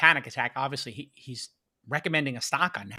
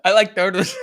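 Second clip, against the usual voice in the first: higher, louder mimic of a kid; turtle kid; 5 semitones higher, 9 dB louder than usual. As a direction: imitate the kid from the viral clip. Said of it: "maybe the kid with the turtles".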